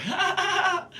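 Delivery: high, loud mock laugh; laugh-cry. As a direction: burst into a giddy laugh. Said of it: "I started to do the laugh cry".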